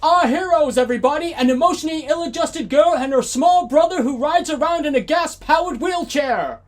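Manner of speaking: loud, booming game-show host; mock announcer. Introducing the film's heroes to the audience as a booming announcer. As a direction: sell the heroes like a showman.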